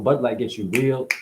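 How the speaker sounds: ordinary delivery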